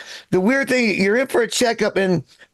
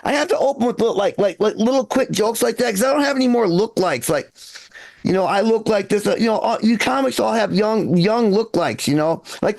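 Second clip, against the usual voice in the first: ordinary delivery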